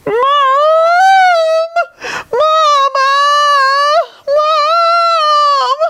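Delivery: very high, loud wailing for mom; howling crying. Acted. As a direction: howl for your mother in tears. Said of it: "the king burst into tears, howling".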